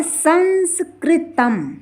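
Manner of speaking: संस्कृतम drawn out slowly as "san-skri-tam"; n inside, m at end. Shown as a demonstration. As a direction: sound out संस्कृतम with n in the middle and m last. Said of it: "संस्कृतम इसे पढ़ेंगे". (संस्कृतम, बीच में न और अंत में म)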